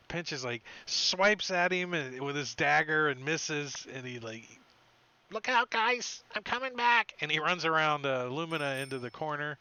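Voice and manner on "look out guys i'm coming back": high, loud call to the group. Acted. Pinch calling to his allies, high and loud. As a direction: shout a warning to your friends in a raised creature voice.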